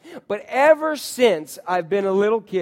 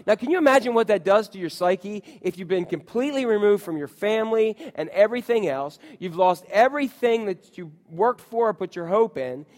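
normal talking voice

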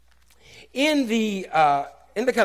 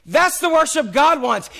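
loud, high proclamation; fervent preacher shouting the point; preaching shout; 9 semitones higher, 6 dB louder than usual. Real, not acted. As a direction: drive it home to the church. Preacher's climax.

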